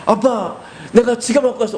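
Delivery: put-on high kid's voice; childish boasting; proud child. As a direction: brag to dad like a child. Child brags about wallet.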